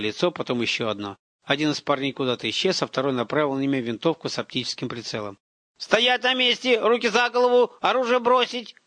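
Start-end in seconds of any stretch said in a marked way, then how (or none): 5.8-8.7 high, imitation tough-cop commands; raised, forceful hands-up order; barked orders. A narrator's reading. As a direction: bark it like a boy playing commando. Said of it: "как он пролаял слова и как изменился его голос".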